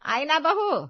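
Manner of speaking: mother-in-law exclaiming in surprise; surprised mother-in-law. Acted.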